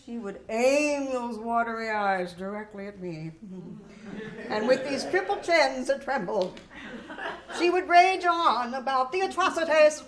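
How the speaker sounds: loud, ranting old teacher mocked with a laugh; raging teacher. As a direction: rage on like the history teacher. Teacher raging about Union atrocities.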